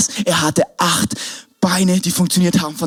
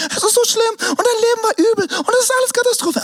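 high, whiny pitying voice; mock whiny pity. Mocking friends who only pity you, whiny high voice. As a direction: mimic the friends who wallow.

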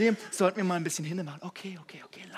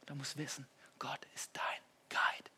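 hushed; 3 semitones lower, 7 dB quieter than usual. Real, not acted. Hushed lead-in before prompt.

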